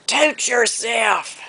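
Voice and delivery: imitated high, long Southern holler; drawn-out holler. Grandmother running a dog out of the yard, hollering. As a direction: stretch it out high like calling across a yard. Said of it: "And she'd holler".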